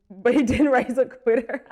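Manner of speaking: laughing through the words. Real, not acted. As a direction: crack up halfway through the line. Cracking up at own joke.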